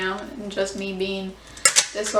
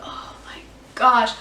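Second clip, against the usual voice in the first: slow, breathless whispered oh my gosh; awed whisper. Real, not acted. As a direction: hush it out in disbelief. Awed at the memory.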